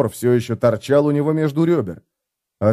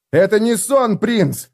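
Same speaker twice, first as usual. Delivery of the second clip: shouting; 9 semitones higher, slower than usual. Acted. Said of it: "прокричал Феликс".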